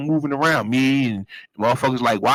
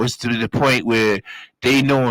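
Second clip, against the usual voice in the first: usual speaking voice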